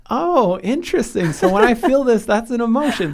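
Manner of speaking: high, loud, wide-eyed mock discovery; mock surprise. Host retelling how he reacted to his therapist, mock astonished. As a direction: play your younger self, amazed at the obvious. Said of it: "And I'm like".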